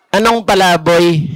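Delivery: a deep, dopey voice mishearing the name; low goofy character asking what smells; comic low mishearing voice. Acted. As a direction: drop low like a dim sidekick. Mishearing gag in other voice.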